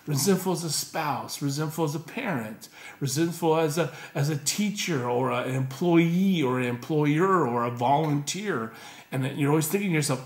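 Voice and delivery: ordinary talk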